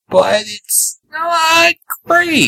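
high, very drawn-out near-whine; pained reluctant admission. Real, not acted. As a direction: stretch it out high, admitting it reluctantly. Exasperated verdict on a show.

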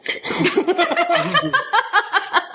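high burst of real laughter, breaking off the reading. Real, not acted. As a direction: crack up laughing mid-story.

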